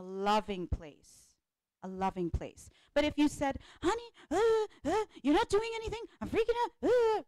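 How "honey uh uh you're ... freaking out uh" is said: high, flustered, stumbling plea; wife freaking out at husband, high and anxious; panicky fearful. Shown as a demonstration. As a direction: blurt it out in a panic. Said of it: "coming from a place of fear".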